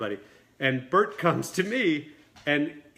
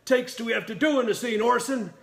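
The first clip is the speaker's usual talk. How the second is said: high-pitched exasperated shout; Burt Reynolds chewing out; 8 semitones higher, 3 dB louder than usual. Retold or imitated. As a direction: imitate an irate actor berating his director. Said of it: "he starts chewing me out".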